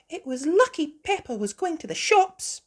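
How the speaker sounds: very high, loud cartoon mother; high-pitched animal mum voice; Mummy Sheep. A narrator's reading. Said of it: "said Mummy Sheep".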